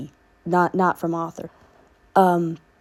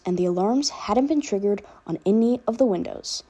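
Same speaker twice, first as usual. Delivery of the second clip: plain reading voice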